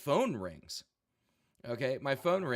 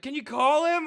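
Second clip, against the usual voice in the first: freaking out; 9 dB louder than usual. Retold or imitated. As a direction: wail it like a drunk friend seeing disaster. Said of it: "He's like".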